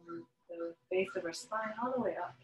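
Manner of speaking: calm yoga breathing guidance with long pauses; unhurried meditation voice, letting each phrase settle; guided meditation. Real, not acted. Yoga teacher settling students into breath and relaxation, guided meditation.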